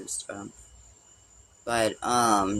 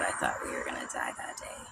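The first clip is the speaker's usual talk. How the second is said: hushed unvoiced whisper; whispered fear remembered; 10 semitones lower, 11 dB quieter than usual. Real, not acted. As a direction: breathe it out like a confession. Recalling nearly dying.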